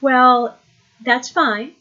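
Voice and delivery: retold unfazed reply, starting high; very calm. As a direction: play it deliberately casual, high lilt on the opening. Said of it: "I very calmly said".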